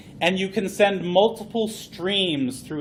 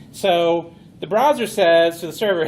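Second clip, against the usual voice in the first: ordinary delivery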